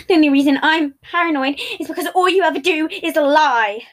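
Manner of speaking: loud, strained accusation; almost shout it; nearly yelling. Acted. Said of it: "Patton was nearly yelling".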